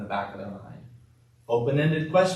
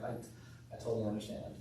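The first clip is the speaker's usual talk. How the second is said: much softer, tender understanding; empathetic, soft; 12 dB quieter than usual. Shown as a demonstration. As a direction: hush it down, full of sympathy. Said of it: "It sounds more empathetic".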